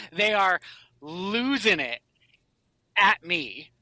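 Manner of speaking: ordinary talk, nothing marked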